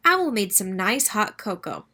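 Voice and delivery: ordinary delivery, nothing marked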